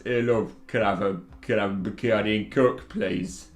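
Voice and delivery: put-on a loosely done Yorkshire accent, ordering at the bar; a lightly put-on Yorkshire barroom accent; terrible Yorkshire accent. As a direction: ask for a drink in a mock Yorkshire accent. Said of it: "That was a terrible Yorkshire accent".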